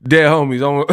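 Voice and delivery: usual speaking voice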